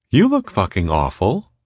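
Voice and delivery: high, cheerful needling; chirpy woman's character voice; cheerful Molly. A narrator's reading. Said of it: "Molly said cheerfully".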